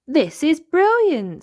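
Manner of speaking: high, loud and slow delight; a child thrilled on a ride; Molly Mole delighted. Acted.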